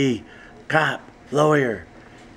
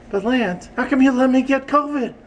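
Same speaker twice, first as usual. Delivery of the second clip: high puppet voice; squeaky puppet making an accusation; Audrey; 7 semitones higher than usual. Acted. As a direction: complain in a high character voice. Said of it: "Here's my Audrey".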